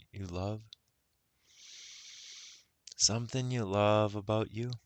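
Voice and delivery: unhurried guided meditation voice. Acted. Guide leading listeners into self-love, guided meditation. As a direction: ease the listener inward, with long pauses.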